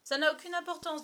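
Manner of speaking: high, loud, know-it-all dismissal; confident expert's pronouncement. Acted. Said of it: "comme s'il était seul à connaître à fond ce problème".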